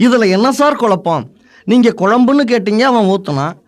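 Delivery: usual speaking voice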